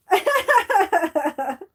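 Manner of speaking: loud, high burst of laughter; high squealing laugh. Real, not acted. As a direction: crack up. Laughing at the impression.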